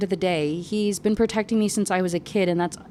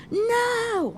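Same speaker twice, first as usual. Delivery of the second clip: high, drawn-out cry at usual volume; mock scream. A narrator's reading. Heroine as Merrick is about to be killed, mock scream. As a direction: stretch a high, restrained scream. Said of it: "I scream".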